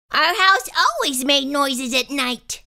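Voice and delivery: plain reading voice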